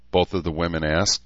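ordinary delivery, nothing marked

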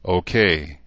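ordinary delivery

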